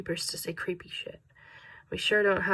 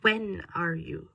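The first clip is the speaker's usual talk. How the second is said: put-on slow, distant German-accented question through static; German accent breaking up on the radio; much slower than usual. A narrator's reading. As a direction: drag it out as if fading in static. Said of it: "static was starting to overtake".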